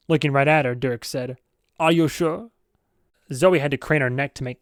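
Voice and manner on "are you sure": put-on light Swedish accent, teasing question; a hint of a Swedish bodyguard, doubting. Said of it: "I know it's more German".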